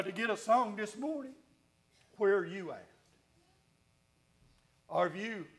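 ordinary delivery

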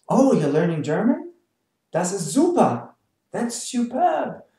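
swooping, impressed exclamation; acted enthusiasm. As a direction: gush in mock admiration. Acted enthusiastic reaction to learner.

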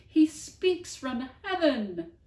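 Bonaparte's slow, very loud joyful cry; awed cry. Acted. Bonaparte hearing the dog's voice from above, a slow loud awed cry. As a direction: call it out in awe. Bonaparte falls to his knees.

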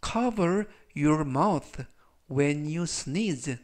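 teacher reading the example sentence very slowly; very slow model reading. Shown as a demonstration. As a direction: cover your mouth when you sneeze read very slowly. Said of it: "즉, 전체적 의미는".

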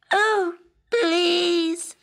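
high, crying frog plea; pleading frog. A narrator's reading. Frog pleading with the princess for a kiss. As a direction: beg with a sob. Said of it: "pleaded the frog".

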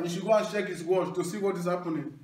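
ordinary talk, nothing marked

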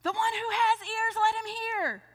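Jesus calling out to the crowd. Acted. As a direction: call it to the crowd. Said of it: "he would call out".

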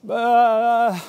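put-on sudden high scream of a scared small child; a short, piercing scream of terror; terrified wail. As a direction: cry out in fright at the snake. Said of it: "I started to wail for my siblings".

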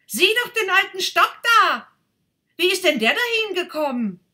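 put-on an eager boy pointing; boy calling out. Boy calls his friend to the stick, loud, high and fast.

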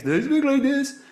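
very high, loud demo voice; shifted voice placement. Shown as a demonstration. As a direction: throw the voice way up high and push it out loud. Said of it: "where is your voice coming from".

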